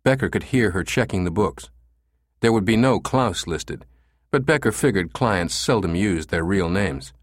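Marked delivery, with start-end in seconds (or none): none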